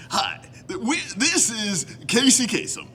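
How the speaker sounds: put-on, high-pitched presenter cadence; Casey Kasem. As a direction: do a famous radio countdown host. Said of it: "break out my Casey Kasem impression".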